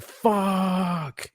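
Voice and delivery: high, long held cry at normal volume; mild mock scream. Shown as a demonstration. Showing how screaming looks crazy, high mock scream. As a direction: draw it out like a held-back scream. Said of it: "if you scream like".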